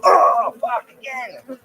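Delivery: high yelp then strained swear; pained yelp. Real, not acted. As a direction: cry out at another sting. Stung again.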